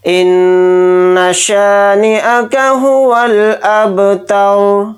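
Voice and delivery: slow, drawn-out chanted tilawat; Quranic recitation. Shown as a demonstration. Teacher opening the lesson, slow chanted recitation. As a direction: intone each word slowly and reverently. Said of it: "एक बार पूरी सूरत को हम repeat करेंगे".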